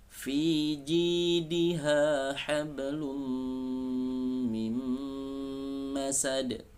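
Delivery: slow, high chanted recitation; Quran recitation. Shown as a demonstration. Teacher recites the verse for students.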